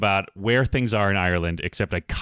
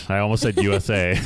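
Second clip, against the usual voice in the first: usual speaking voice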